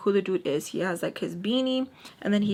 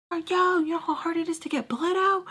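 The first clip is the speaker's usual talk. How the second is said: mock yell; 7 semitones higher than usual. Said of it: "The dude starts screaming like".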